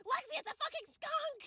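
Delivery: high-pitched re-enacted alarm; alarmed warning. Friend warns Lexi about a skunk, high alarmed voice.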